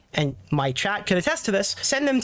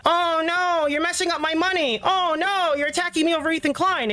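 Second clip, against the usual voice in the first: high-pitched mocking wail; mock whining; 11 semitones higher than usual. Streamer dismissing a petty complaint, mock whining. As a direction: whine it like an exaggerated complainer.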